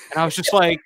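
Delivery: normal talking voice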